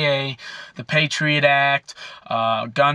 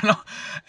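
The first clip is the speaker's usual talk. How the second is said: breathless scoffing laugh; 7 semitones higher, 3 dB quieter than usual. Real, not acted. Laughing at the cop.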